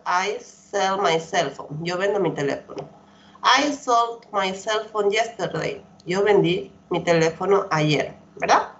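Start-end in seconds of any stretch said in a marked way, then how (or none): 0.1-1.8 very slow, careful English sentence; slow model sentence. Shown as a demonstration. Teacher modelling an example sentence for her class, very slowly. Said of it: "model sentence for students". 3.4-5.8 very slow, careful English sentence; slow model sentence. Shown as a demonstration. Teacher modelling an example sentence for her class, very slowly. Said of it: "model sentence for students".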